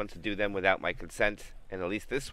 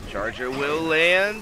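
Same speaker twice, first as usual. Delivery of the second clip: higher, louder, slow hype call; excited call; 9 semitones higher, 10 dB louder, much slower than usual. Real, not acted. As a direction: land rising on land. Big play call.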